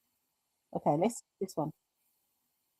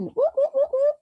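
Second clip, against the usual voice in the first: high, chanted whoops; whooping cheer; 3 dB louder than usual. Real, not acted. Celebrating a viewer's answer.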